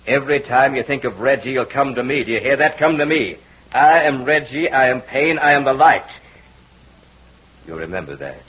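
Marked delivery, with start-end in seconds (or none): 0.1-6.0 booming hypnotic command. Acted.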